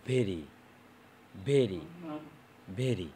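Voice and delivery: "ভেরি" with a buzzing voiced v; voiced v demo. Shown as a demonstration. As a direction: repeat "ভেরি" as separate falling words, vocal cords vibrating on v. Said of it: "তখন কিন্তু ঠিকই আমাদের vocal কর্ডটা কাঁপবে".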